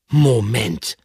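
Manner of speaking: loud, sharp stop; interrupting exclamation. Acted. Pikandu interrupts the hamster, loud and firm. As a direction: cut in firmly.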